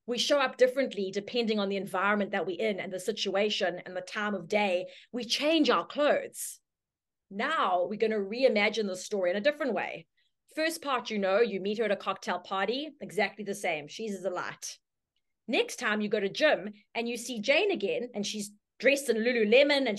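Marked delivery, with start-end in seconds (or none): none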